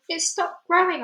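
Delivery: high excited cry. Acted. Aunt watching the peach, high excited cry. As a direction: cry out the news. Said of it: "Aunt Spiker cried".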